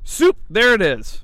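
high, loud "Soup" like a hook; catchphrase shout. Pretend. Host pitching a soup show name, loud playful catchphrase. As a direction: belt out the punning title.

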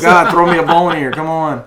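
loud, high exasperated outburst; exasperated plea. Real, not acted. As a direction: beg loudly for a little help. Mock exasperated plea to co-host.

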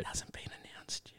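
whisper. Pretend. Whispering about unannounced secret.

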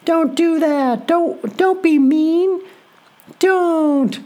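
high, pleading mock whine; mocking Natalya scolding from commentary in a high whine; whiny coach voice. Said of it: "she started blowing her whistle and be like".

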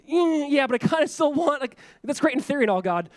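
dismissive king. Retold or imitated. Ahaz voiced brushing God off.

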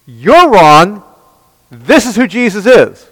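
loud, high preaching voice; fiery sermon delivery. Acted. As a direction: preach it hard to the room. Preaching at full force.

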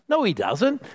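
ordinary talk

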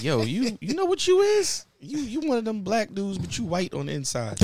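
high-pitched retold schoolyard roast; mock teasing. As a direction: act out classmates ribbing you. Acting out classmates teasing him.

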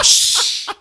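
loud unvoiced shush; classmates shushing. Kids shushing the class clown, loud and unvoiced. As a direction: hush him like the whole class. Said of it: "the kids would be shushing me".

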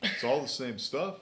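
lightly voiced laid-back wrestler; big sexy voice. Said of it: "do the big sexy voice".

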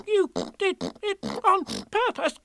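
sputtering through soap; spluttering. A narrator's reading. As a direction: splutter with mouth full of lather. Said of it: "spluttered Piglet".

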